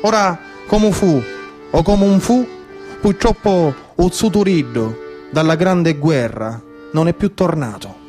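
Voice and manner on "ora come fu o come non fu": ordinary delivery, nothing marked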